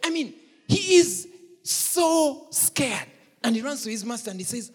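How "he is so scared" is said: high, loud, very slow stress; stressed fear. Acted. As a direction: drag out so scared.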